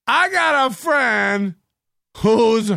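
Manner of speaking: exaggerated, drawling repetition; mock over-enunciated repeat. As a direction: stretch every word out as if for someone who misheard. Repeats himself to a listener who misheard.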